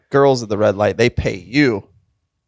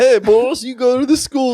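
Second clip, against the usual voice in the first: high put-on voice hailing boys; comic high-pitched stranger imitation; imagined homeless man; 11 semitones higher than usual. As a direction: play the odd street guy greeting youngsters. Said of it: "I imagine that's what he sounded like".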